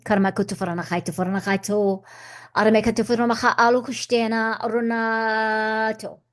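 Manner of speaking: even, rhythmic chant of invented syllables; channelled light language. Acted. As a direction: intone it like a channelled blessing. Light language mid-talk.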